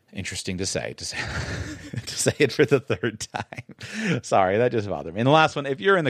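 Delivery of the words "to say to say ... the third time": breaking into laughter mid-phrase; higher, slow words squeezed through a laugh; laughing at own slip. Real, not acted. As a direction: laugh helplessly while repeating yourself.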